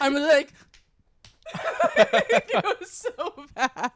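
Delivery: cracking up at herself; laughing. Real, not acted. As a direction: gasp it out laughing. Laughing while telling.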